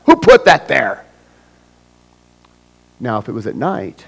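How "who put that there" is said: higher, louder angry rant at the rail; mad driver. Acted. Angry driver blaming the guardrail, mock rage. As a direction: rant at the guardrail like it wronged you. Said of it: "And then we get mad".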